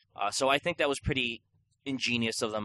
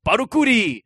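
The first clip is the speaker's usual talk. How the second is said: put-on high, loud caricature of an old shopkeeper; mock yell as an old Chinese man; 9 semitones higher, 10 dB louder than usual. Shopkeeper calling out to customer, mock yell. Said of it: "an old Chinese man".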